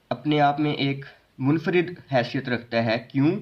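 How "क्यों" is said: ordinary talk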